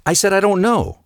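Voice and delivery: high, fed-up mock reply; irritated wife. Wife fed up with her husband's questions, high mock irritation. Acted quarrel, wife snapping.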